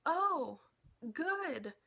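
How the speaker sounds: high, loud, delayed answer; imitating awkward reply. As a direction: imitate a starstruck friend squeaking out a reply. Friend answers after long stare.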